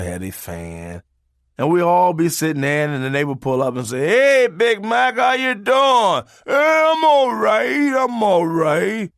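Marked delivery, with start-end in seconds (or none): 4.1-6.2 high, slow call across the yard; neighbor calling out. Retold or imitated. 6.5-9.1 high, slow answering shout; calling back. Retold or imitated.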